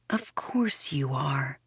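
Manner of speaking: slow breathy whisper, barely voiced; mouthed whisper. A narrator's reading. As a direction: mouth the words without sound. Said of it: "she mouths".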